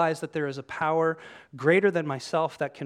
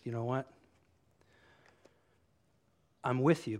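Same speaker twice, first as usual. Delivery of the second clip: commit without any excitement; not super excited; 2 semitones lower than usual. Acted. Peter stays with Jesus for lack of alternatives, not super excited. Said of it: "Peter doesn't even sound super excited".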